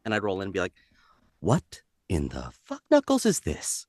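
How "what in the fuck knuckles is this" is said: snarky antihero voice; Deadpool voice. Retold or imitated. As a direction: lower Deadpool impression. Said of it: "we want Deadpool".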